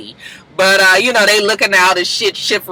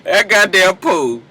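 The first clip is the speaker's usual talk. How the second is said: normal talking voice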